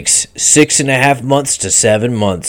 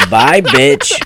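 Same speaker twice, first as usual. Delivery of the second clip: laughing; 7 semitones higher than usual. Acted. Laughs while dismissing patient.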